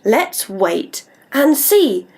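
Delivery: slow, drawn-out; slow sing-song. Shown as a demonstration. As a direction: stretch each word for the child to copy. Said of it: "I'd like you to say".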